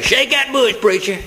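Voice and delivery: high-pitched mock holler to the preacher; congregant's shout. Somebody in the service egging the preacher on, imitated shout. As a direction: call it out high like an excited churchgoer. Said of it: "you hear somebody say".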